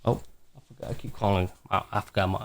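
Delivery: dropped to a hushed voice. Real, not acted. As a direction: keep it low so the dog sleeps. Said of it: "I just woke her up".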